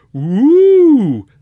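loud, high jeering woo; mocking woo. A narrator's reading. Emboldened students hoot at the porter. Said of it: "ventured another".

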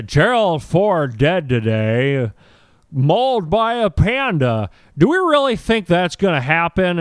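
a hint of a slow anchorman; newsman impression. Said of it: "Dana Carvey as Tom Brokaw".

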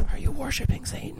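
high, whispered mock parent; high whispered parent gasp; mock worried parents. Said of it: "my parents were like".

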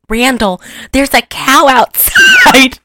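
high, loud, slow worried wife voice; a jittery farm wife calling her husband; old farm wife. Retold or imitated. Wife at the window tells husband of a cow, high alarmed voice. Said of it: "she goes".

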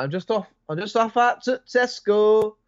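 a bewildered man heading to Tesco; hammed-up timid shopper; nervous bloke voice. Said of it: "you're just like".

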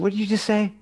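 high, sharp disbelief; incredulous anger. Retold or imitated. Host confronting a guest who insulted the stripper, high and indignant. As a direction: demand she repeat herself. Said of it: "I said".

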